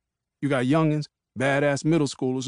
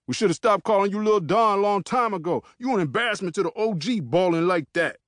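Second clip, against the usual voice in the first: mocking taunt; 7 semitones higher than usual. Peanut taunting Lil Don.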